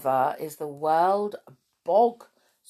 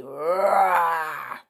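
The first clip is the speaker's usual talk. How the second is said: lion roar; 6 dB louder than usual. Acted. World Lion Day.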